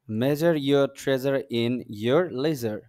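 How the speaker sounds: very slow, word-by-word reading; model sentence. Shown as a demonstration. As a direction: spell it out slowly for learners. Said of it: "বলতে সুবিধা হয়".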